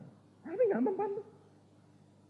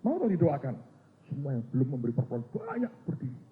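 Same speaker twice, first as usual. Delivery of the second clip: ordinary talk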